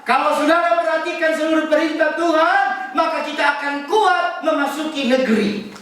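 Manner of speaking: high, ringing preacher's voice; insistent sermon delivery, pitched well up; emphatic preaching. Real, not acted. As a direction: exhort the congregation at a high pitch.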